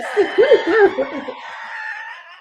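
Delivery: very high, loud shriek of laughter; real, uncontrolled cackle. Real, not acted. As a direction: burst out in a squealing laugh. Cracks up at ninety-year-old joke.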